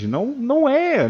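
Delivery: high teasing no; mock denial. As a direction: sing out the denial, drawn out. Teacher contradicting the math view.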